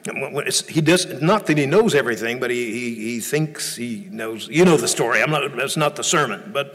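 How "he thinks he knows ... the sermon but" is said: ordinary talk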